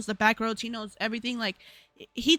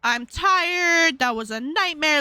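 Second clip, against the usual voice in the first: tired mom; 4 semitones higher, 5 dB louder, slower than usual. Retold or imitated. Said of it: "And she's like".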